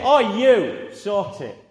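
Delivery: loud, pointed mock order; acted telling-off, loud then falling away; mock individual command. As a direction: call out one person, then drop off. Imagined order to one individual.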